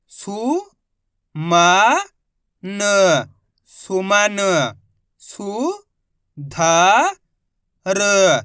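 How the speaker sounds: teacher spelling each syllable aloud; syllable-by-syllable reading. Shown as a demonstration. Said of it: "मेरे तरीके पे गौर करना है।". (अक्षर-अक्षर ज़ोर से बोलकर पढ़ाना)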